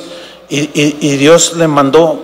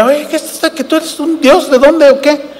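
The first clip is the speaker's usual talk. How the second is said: astonished Pablo; 8 semitones higher than usual. Retold or imitated. Preacher voices astonished Pablo.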